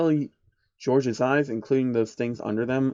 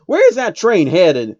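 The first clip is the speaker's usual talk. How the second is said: higher, louder, slow urgent question; Applejack; 6 semitones higher, 7 dB louder than usual. Acted. Applejack questioning the teller, loud urgent cowgirl voice. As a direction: press the teller for answers.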